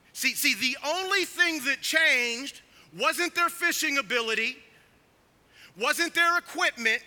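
loud, high, emphatic pulpit delivery; full-force preaching. Real, not acted. Preacher telling congregation what did not change, full-force preaching.

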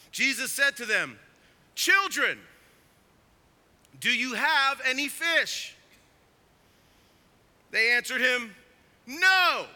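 loud, high pulpit reading; forceful scripture reading. A narrator's reading. Preacher reading the disciples' exchange with Jesus to the congregation, proclaimed loudly.

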